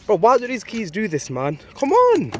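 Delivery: exasperated at stuck keys; frustrated. Real, not acted. Keys won't open in cold.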